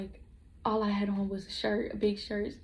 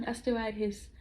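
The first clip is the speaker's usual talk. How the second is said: normal talking voice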